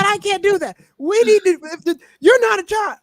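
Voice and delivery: high-pitched, exasperated comic rant; Bill Burr bit. Retold or imitated. Retelling a favorite comedian's joke, mock exasperation. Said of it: "one of my favorite Bill Burr jokes".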